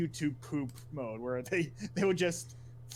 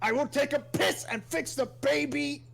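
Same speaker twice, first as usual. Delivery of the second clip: mock villain shout; 11 semitones higher, 5 dB louder than usual. Joking villain quote.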